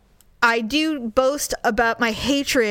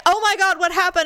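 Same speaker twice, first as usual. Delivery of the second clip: higher, alarmed question on the phone; alarmed husband; 8 semitones higher, 3 dB louder than usual. Retold or imitated. Husband on speaker hears the crunch, alarmed. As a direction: imitate a startled, worried husband.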